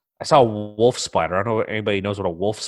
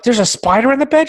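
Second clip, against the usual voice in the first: high, loud incredulous echo; can't believe there's a spider in the bed; alarmed disbelief; 13 semitones higher, 6 dB louder than usual. Retold or imitated. Woken husband echoing his wife's spider warning, high and loud.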